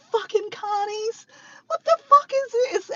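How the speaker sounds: incredulous, high retold swearing; mock outrage. Retold outburst at the pranksters.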